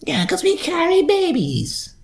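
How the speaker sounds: high, slow mocking falsetto; mocking woman. Mimicking women's excuse about weight, in high mocking falsetto.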